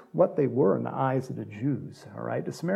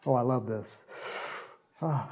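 ordinary talk

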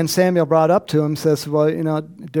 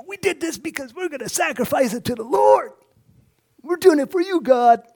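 higher, sugary pious pleading; mock pious excuse; 10 semitones higher than usual. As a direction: fake holy innocence. Disguising sin as pious talk.

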